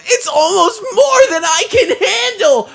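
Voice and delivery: high, slow, breathless anime narration; earnest determined anime hero. Acted. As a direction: swoon like a lovestruck anime lead. Continues anime protagonist monologue.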